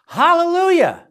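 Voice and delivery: high, loud hallelujah; jubilant exclamation. Real, not acted. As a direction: let the praise ring out high and loud. Rejoicing after reading forgiveness verse.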